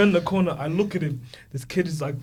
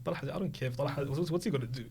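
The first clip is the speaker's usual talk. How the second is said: much softer, under the breath; a cocky little mumble; child shrugging it off quietly; muttered defiance; 3 semitones lower, 10 dB quieter than usual. Retold or imitated. Small kid dismissing the threat quietly.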